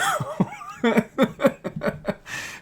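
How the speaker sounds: high, long laughing fit; mocking laughter. Real, not acted. Reader ridicules the vague allegation, laughing. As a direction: burst out laughing after the mock dismay.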